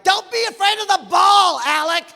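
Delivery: high, loud ballfield yell; imitated kid hollering across the diamond; yelling son. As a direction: shout it at a hopeless teammate. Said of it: "where he yelled at me".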